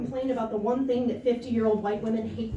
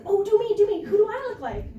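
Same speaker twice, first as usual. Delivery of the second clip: put-on eager nine-year-old; 7 semitones higher than usual. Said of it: "And I'm nine, I'm like".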